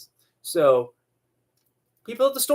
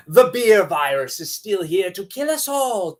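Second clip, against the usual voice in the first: ordinary talk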